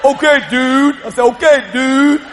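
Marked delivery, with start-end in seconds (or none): 0.0-0.9 a jokey put-on dude voice, louder; mocking voice. 1.3-2.2 a jokey put-on dude voice, louder; mocking voice. Repeats mock dude voice.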